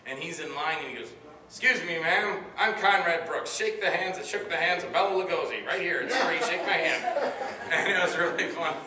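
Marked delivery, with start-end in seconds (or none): 1.5-7.3 louder, higher-pitched eager self-introduction; eccentric old-timer impression. Actor introduces himself to a woman in line at McDonald's, loud and high. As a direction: pester a stranger loudly for a handshake. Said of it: "Conrad's a, a trip to be with".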